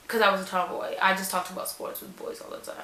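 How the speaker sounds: normal talking voice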